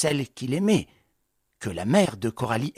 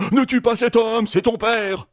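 imitation moqueuse; 8 semitones higher, 4 dB louder than usual. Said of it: "imite le concierge".

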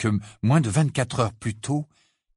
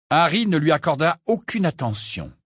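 ordinary delivery

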